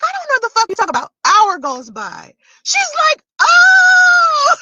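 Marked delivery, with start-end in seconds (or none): 0.0-1.1 fast, higher brush-off; lightly voiced mother. Vlogger quoting her mother brushing off the question, fast. 3.4-4.6 high, loud sudden Oh with a laugh; mock realization. Vlogger acting her mother's sudden realization, loud high oh.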